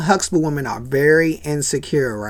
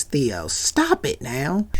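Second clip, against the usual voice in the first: low, slow, soft warning; quiet final warning to son; stern mother; 3 semitones lower, 7 dB quieter, much slower than usual. Retold or imitated.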